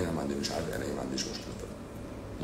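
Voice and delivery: low, soft, baffled repeat of the claim; incredulous mutter. Real, not acted. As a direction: mutter it back in disbelief. Doctor repeats patient's claim incredulously.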